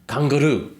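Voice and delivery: imitated intense exclamation. Japanese man reacting to Australia, imitated intense exclamation. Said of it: "looks at me intensely and he goes".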